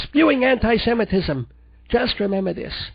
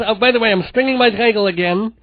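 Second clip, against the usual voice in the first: usual speaking voice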